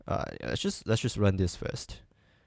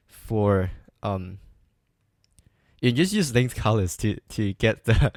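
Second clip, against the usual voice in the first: ordinary talk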